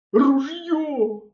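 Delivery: high, loud gurgled cry from the water; gurgling cry. A narrator's reading. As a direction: cry out half under water. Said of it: "булькнув він". (мисливець булькає з води про рушницю)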